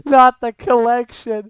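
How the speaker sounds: loud, slow, dramatic wail; dramatic cry. Acted. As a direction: wail it in mock horror. Mock dramatic gasp at meme.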